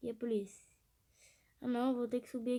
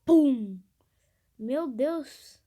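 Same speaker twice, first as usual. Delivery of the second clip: susto alto; 12 dB louder, much slower than usual. Real, not acted. Pneu estoura.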